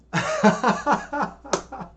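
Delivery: real laughter. Real, not acted. Laughing at chat's jab.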